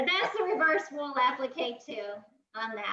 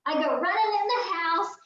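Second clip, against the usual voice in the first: usual speaking voice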